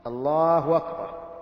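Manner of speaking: "الله أكبر" as Allāāhu wakbar, slowly stretched; hamza turned to waw. Shown as a demonstration. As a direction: draw out "الله", then glide into wakbar. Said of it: "تقلب الهمزة المضموم ما قبلها واوا". (نطق "الله أكبر" ببطء وبواو مكان الهمزة)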